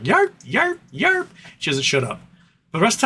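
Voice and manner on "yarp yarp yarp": high mock yapping, each yarp rising; yappy dog bark. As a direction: yap it out like a pushy little dog. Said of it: "And she's like".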